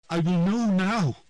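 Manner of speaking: loud, high insistence; defiant declaration. A narrator's reading. Triss insists on knowing, loud and defiant. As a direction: refuse to be denied.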